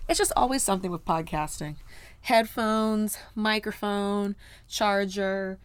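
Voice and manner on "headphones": normal talking voice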